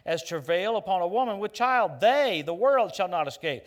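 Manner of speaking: loud, ringing preacher's declamation; loud pulpit voice driving each phrase home; full-force preaching. Acted. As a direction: preach it out to the whole hall.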